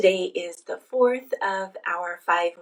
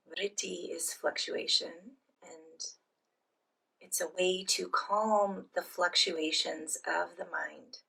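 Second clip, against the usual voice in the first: soft, unhurried phrases with long pauses; guided meditation; 4 dB quieter than usual. Real, not acted. Leads guided breathing meditation.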